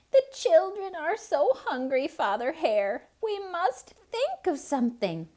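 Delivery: high, loud mother hare pleading with her husband; a worried mother hare begging Father Hare to think of something; Missus Hare calling out. Acted. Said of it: "Missus Hare cried".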